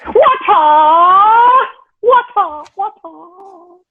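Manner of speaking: high shouted kung fu cry; Bruce Lee kiai. Retold or imitated. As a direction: screech it like a martial arts kiai.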